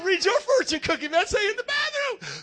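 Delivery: loud, high, excited shout at his friend. Retold or imitated. Preacher to a friend over fortune cookies, loud excited shout. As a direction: yell it out, thrilled, punching bathroom. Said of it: "I said".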